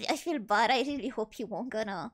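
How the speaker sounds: ordinary talk, nothing marked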